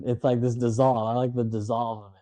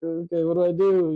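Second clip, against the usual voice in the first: mock helpless worry; 6 semitones higher than usual. Retold, left alone hanging.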